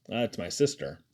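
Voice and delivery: ordinary delivery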